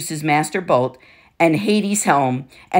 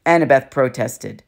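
plain reading voice

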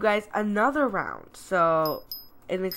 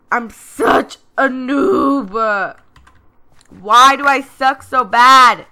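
mock whine; 3 semitones higher, 9 dB louder, much slower than usual. Whining after loss.

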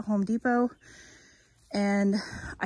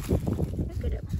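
whispering; 4 dB quieter, slower than usual. Real, not acted. Hushed look at potato sprouts.

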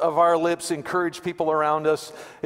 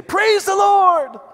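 slow, high, loud exclamation of delight; joyful praise; 9 dB louder, much slower than usual. Retold or imitated. Old lady finding groceries on her porch, slow joyful high cry. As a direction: burst out with thanks. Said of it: "the first thing she did was say".

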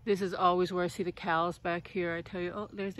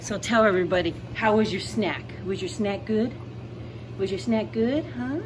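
pet talk; 4 semitones higher, 5 dB louder than usual. Real, not acted. Talking to dog, loud and high.

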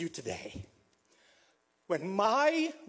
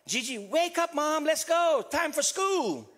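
put-on higher-pitched, insistent child's wake-up call; imitated child's call, pitched up; child waking mom; 6 semitones higher than usual. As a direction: rouse mom like an impatient child. Children waking their mother, voiced.

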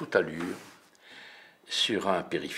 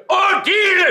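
high, loud calling out; yelling for Odile; shout; 16 dB louder, slower than usual. Acted. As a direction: call her name across the store. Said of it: "Je crie".

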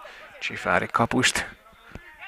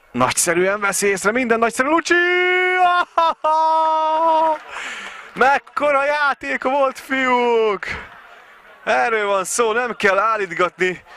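much higher, louder, thrilled; excited commentary; 12 semitones higher, 3 dB louder than usual. Real, not acted. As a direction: burst out in delight at the play. Commentator celebrating a great play.

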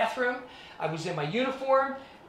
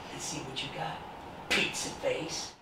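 quiet menace; 4 semitones lower, 8 dB quieter than usual. Retold or imitated. Bully leaning in menacingly.

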